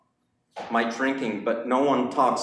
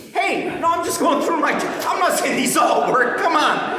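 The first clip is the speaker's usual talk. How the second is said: loud protest; 13 semitones higher, 3 dB louder than usual. Real, not acted. Defending jokes to the crowd.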